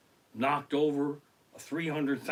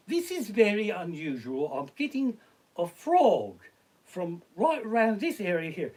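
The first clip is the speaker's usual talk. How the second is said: higher-pitched imitation British medium; mock British accent; 5 semitones higher than usual. Comic imitates psychic addressing her audience, mock British accent. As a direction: put on a British accent, picking up vibes. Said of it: "British accent".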